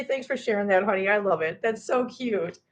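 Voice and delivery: ordinary talk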